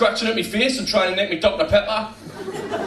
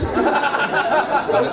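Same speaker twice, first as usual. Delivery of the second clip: slow deadpan name drop, again deadpan; Alan Shearer impression; 9 semitones higher than usual. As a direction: drag the name out, straight-faced. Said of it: "don't say the one you thought it was".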